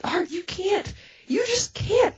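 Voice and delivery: very high, distressed protest; acted-out desperate plea; panicked pleading. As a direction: beg him in a squeaky panic. Begs him not to leave.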